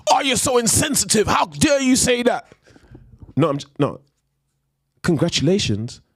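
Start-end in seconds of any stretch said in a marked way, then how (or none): 0.1-2.5 high, whiny voice of an offended critic; mock offended critic. Mocking critics who call him insensitive, high whiny voice. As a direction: parody the outraged people online. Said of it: "People are gonna get onto me like".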